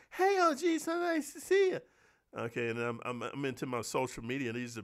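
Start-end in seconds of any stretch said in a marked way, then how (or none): 0.1-1.8 high, airy mock voice of a star who forgot him; snobbish actress. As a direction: play a haughty starlet, pitched way up. Mocks actress greeting him.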